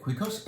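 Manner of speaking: Cuicos quick and high, KWEE-kos, rising at the end; checking how to say Cuicos; tentative pronunciation. Shown as a demonstration. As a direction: repeat Cuicos as a question. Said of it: "I think that's how you say it".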